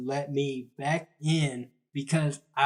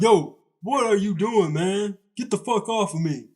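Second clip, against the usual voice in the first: loud, higher imitation of a freaked-out man; yelling; 5 semitones higher, 5 dB louder than usual. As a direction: yell to get him off you. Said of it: "He started squirming and yelling".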